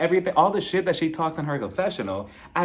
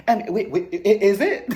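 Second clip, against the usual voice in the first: higher, stammering mock alarm; imitated panic; 6 semitones higher, 4 dB louder than usual. Dorit unsure of her own jacket, mock panic. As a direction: play her flustered, breaking into laughter. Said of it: "Miss Fashion started panicking".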